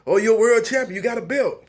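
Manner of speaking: high-pitched mock excitement; mock congratulations. Mock consolation to an unsatisfied boxer.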